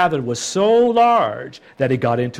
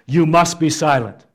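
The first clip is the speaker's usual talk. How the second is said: aggressive command; 3 semitones higher, 6 dB louder than usual. Acted. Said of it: "more aggressive in its translation".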